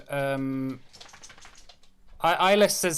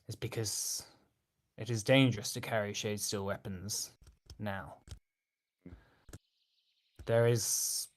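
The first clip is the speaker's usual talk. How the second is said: lightly voiced Eyeless; 4 semitones lower, 5 dB quieter than usual.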